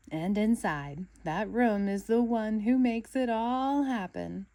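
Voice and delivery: slow, loud and trembling with awe; quavering reverent build-up; quivering voice. A narrator's reading. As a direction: let the voice quiver with excitement. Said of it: "SpongeBob's voice quivered".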